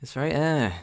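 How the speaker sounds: loud, high, drawn-out disappointed eh; unimpressed meh. Real, not acted. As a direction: groan your disappointment out loud. Unimpressed groaning at new song.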